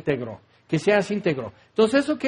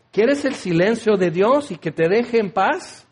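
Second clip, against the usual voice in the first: usual speaking voice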